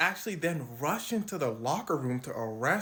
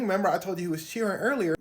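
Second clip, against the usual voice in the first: ordinary delivery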